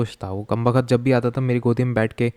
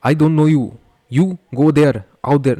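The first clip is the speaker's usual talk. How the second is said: put-on loud, higher-pitched African accent; exaggerated African accent, forceful phrases; mock African accent; 4 semitones higher, 8 dB louder, much slower than usual. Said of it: "African ऐसे बोलते हैं".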